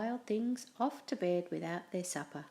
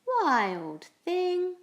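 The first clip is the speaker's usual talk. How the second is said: high, loud call across the house; 10 semitones higher, 5 dB louder than usual. Acted. Mother calls out to Max, high and loud. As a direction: call him like an exasperated mother. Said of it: "His mother called him".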